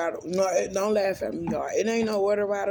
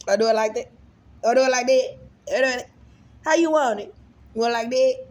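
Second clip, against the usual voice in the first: playful hyping; 5 semitones higher, 7 dB louder, faster than usual. Pretend. Playfully striking thumbnail poses for camera.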